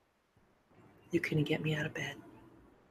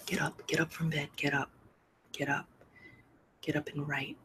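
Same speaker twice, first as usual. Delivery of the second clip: retold whisper; 5 dB quieter than usual. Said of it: "He whispers in my ear".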